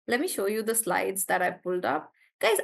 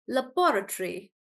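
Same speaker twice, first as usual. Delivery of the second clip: laboratory as luh-BOR-uh-tree; British stress on BO. Shown as a demonstration. Teacher repeating the British laboratory, stress on bo. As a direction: hit the second syllable of laboratory, "bo". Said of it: "they are stressing on bo".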